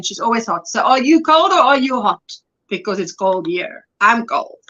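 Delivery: usual speaking voice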